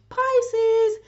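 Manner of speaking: calling her fellow fish; excited call-out. Real, not acted. Calling out her own sign.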